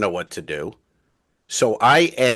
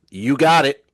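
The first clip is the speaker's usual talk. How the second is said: louder, higher retold retort; annoyed; 6 semitones higher, 6 dB louder than usual. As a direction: imitate her pointed, irritated comeback. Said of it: "Very annoyed".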